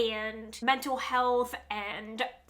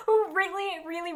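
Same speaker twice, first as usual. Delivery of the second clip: laughing; 7 semitones higher than usual. Real, not acted. Laughs at being one of few.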